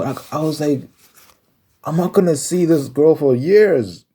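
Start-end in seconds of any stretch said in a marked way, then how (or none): 1.8-4.0 high, loud, slow exasperation; pissed off. Retold or imitated. Telling the friend she lost her last chance, a high loud exasperated plea. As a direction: let the frustration ring out.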